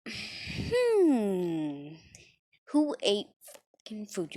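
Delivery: high, loud, sniffing out the culprit; a hammed-up outraged teacher demanding who ate it; mock angry teacher. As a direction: play the furious sensei finding the food gone. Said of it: "He would be like".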